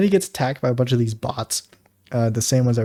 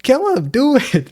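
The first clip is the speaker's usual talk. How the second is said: much higher, eager egging-on; a high, gleeful little instigator; eager high instigating voice; 12 semitones higher, 4 dB louder, slower than usual. Retold or imitated. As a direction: egg him on in a high kid voice. Voicing Oliver again.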